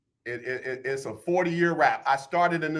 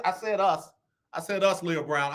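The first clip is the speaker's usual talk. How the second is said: usual speaking voice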